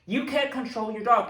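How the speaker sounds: high, loud indignant accusation; retold scolding. Dog owner confronting a stranger, retold scolding. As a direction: scold the other owner, re-enacted.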